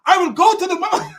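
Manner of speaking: fast, high mock boy voice; boy's voice. Boy stupidly answering his father, quick high voice. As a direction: rattle it off high as the silly son. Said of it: "The boy says".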